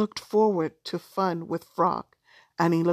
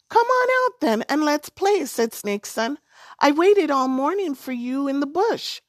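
coaxing snake; 7 semitones higher, 4 dB louder than usual. A narrator's reading. Snake coaxing frog to play.

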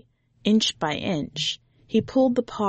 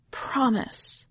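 whispered promise; 5 semitones higher than usual. A narrator's reading. Boy whispers his promise to the tiger. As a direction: whisper the vow.

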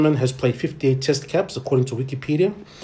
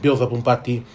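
normal talking voice

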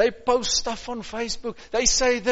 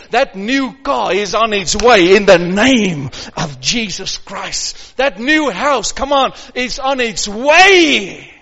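confident declaration; 8 dB louder than usual. Acted. Declaring blessings over the congregation.

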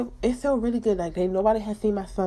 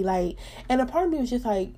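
ordinary delivery

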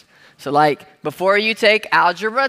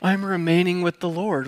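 mock churchy; 2 semitones lower, 3 dB quieter than usual. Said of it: "it sounds so churchy".

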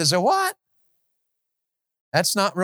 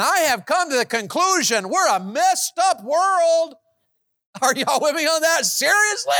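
preaching at full force; 10 semitones higher than usual. Acted. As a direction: preach it out, building to a peak.

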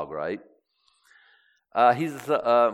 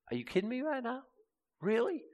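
high, hushed mock-incredulous God; upset disbelief; 12 semitones higher, 7 dB quieter, faster than usual. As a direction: squeak it out softly, as if you can't believe it. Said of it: "He gets very upset".

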